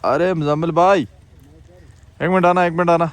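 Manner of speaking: loud call across the snow. Real, not acted. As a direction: call him over, loud and drawn out. Calling out to friend.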